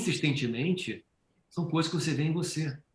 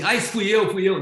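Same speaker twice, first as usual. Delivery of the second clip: eager claim; 7 semitones higher, 7 dB louder than usual. Retold or imitated. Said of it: "fala assim".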